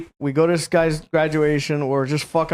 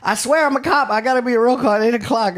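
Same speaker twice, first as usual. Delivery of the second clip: high-pitched, hurried protest; squeaky, rushed begging; mock frantic pleading; 7 semitones higher, faster than usual. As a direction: imitate a panicked friend swearing it's true. Friend pleading with the trooper.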